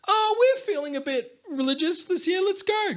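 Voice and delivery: high-pitched, put-on parent voice; high sing-out, imitating a parent; mock parent announcement. Acting out parent announcing church outing.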